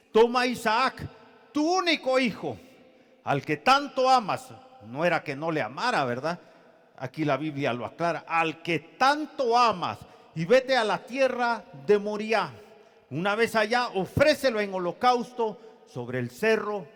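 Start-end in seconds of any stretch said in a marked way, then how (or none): 0.1-4.5 loud, high, phrase-by-phrase proclamation; declaimed scripture. Acted. God commanding Abraham to take his son, loudly proclaimed. 8.3-16.9 raised, emphatic Bible proclamation; declaimed scripture. Acted. God sending Abraham to Moriah, loudly proclaimed.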